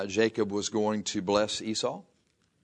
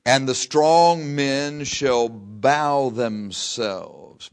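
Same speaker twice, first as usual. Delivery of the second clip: slow, loud, weighty reading of the verse; intoned verse from the pulpit; solemn scripture reading; 4 semitones higher, 8 dB louder, much slower than usual. Acted. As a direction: lean on every word of scripture.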